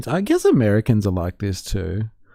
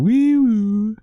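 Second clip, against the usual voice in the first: high wee woo siren call; playful siren noise; mock siren; 11 semitones higher than usual. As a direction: sing it like a siren.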